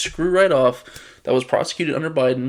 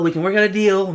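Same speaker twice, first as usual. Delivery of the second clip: higher, louder eager negotiator; second, more insistent take of the mock plea; mock dealmaker voice; 6 semitones higher, 5 dB louder than usual. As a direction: repeat the offer pushing harder, pitch up. Repeated mock deal offer.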